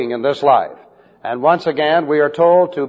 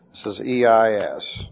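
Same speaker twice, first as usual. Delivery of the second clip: ordinary talk, nothing marked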